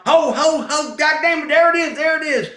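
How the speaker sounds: very high, frantic mimicked shouting; startled outburst. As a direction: imitate a man jumping in alarm. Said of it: "I hear him jump".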